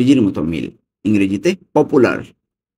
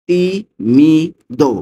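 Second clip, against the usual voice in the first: slow repeat; 6 semitones higher, 4 dB louder, much slower than usual. Shown as a demonstration. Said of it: "teacher repeats slowly".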